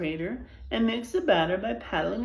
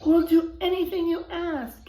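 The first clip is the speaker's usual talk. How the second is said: higher, louder desperate plea; pleading; 9 semitones higher, 4 dB louder than usual. Acted. Sheriff pleading with the widow, desperate and high. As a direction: beg like your life depends on it. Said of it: "pleaded the sheriff".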